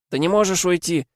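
put-on high, tearful child's plea; crying child. A narrator's reading. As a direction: cry out, begging him not to leave. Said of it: "плакал Топпер".

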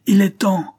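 "il est temps" howled high and loud, falling; old convict woman howling the signal again. A narrator's reading. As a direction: shriek it again to launch the attack. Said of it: "hurla la vieille Flay".

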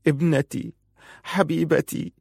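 high-pitched, grieving woman; wailing lament. A narrator's reading. Mother mourns her dead daughter, high restrained lament. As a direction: mourn a lost daughter, acted without loudness. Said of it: "كانت تجهش بالبكاء وتنعي فرون".

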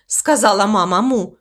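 ordinary delivery, nothing marked

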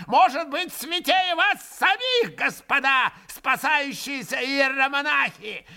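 high shrill mocking old man; ranting old man. A narrator's reading. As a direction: sneer and shriek at the monks. Old man rants at monks.

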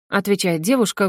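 ordinary delivery, nothing marked

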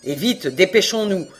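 loud, brusque refusal to listen; big blustering voice, good-naturedly bossy; jovial thundering. A narrator's reading. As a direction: boom it out, brushing off all excuses.

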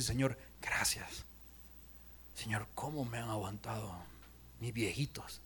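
low, very soft, whispered thanks; hushed prayer. Acted. Grown child thanking God for patient parents, hushed prayer. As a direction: murmur it privately to God, almost voiceless. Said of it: "Y nos sentamos y comenzamos a decir".

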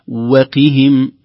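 "وقهم" chanted slowly with a full kasra on the qaf; correct pronunciation. Shown as a demonstration. Teacher models the correct word. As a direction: separate wa and press the qaf in "وقهم". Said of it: "لا نختلس كسرة القاف".

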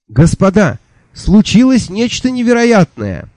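high, breathless, amazed announcement; flustered excited archivist. Acted. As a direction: burst in with high amazed news.

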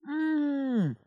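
high, drawn-out "mmm"; high-pitched closed-mouth protest; muffled moan. A narrator's reading. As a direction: moan through a covered mouth, falling away. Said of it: "замычала я".

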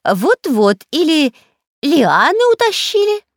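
high, loud spooky warning; scary spy voice. Acted. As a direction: frighten them on purpose. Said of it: "ещё больше испугал всех".

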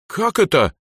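high, loud outraged question; indignant. A narrator's reading. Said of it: "возмутилась Эльза".

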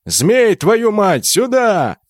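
high, forceful summoning yell; swearing shout across a battlefield. A narrator's reading. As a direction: bellow for him to come over. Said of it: "и заорал".

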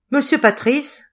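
formidable, arresting summons; commanding call. A narrator's reading. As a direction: call out the name to stop him in his tracks. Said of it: "sonnèrent si formidablement aux oreilles".

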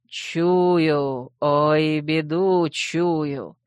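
low, very slow doom voice; sepulchral voice. Acted. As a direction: drone it like a grim prophet. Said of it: "за могильным голосом". (могильным голосом, медленно)

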